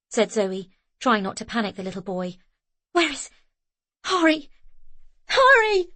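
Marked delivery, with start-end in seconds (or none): 2.9-5.9 high, loud desperate shout; frantic calling. A narrator's reading. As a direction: scream his name in panic. Frantic search for missing child.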